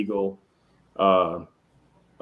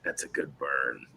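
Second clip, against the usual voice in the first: impressed, low and slow; 6 semitones lower, 8 dB quieter, slower than usual. Retold or imitated. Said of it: "I was like".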